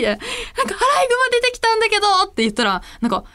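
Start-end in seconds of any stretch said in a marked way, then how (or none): 0.6-2.1 high, quick, near-tearful complaint; whiny alarm. Retold or imitated. Telling her mother about the raccoon, near tears. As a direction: tell mom in a rush, nearly crying.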